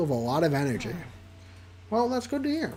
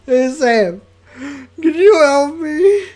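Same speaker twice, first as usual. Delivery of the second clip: high, loud, slow sob-talk; Jaden crying; 10 semitones higher, 13 dB louder, much slower than usual. Acted. Jaden begging Sam for help, crying hard. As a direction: blubber the plea through tears. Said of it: "the person who I voice as if she's constantly crying".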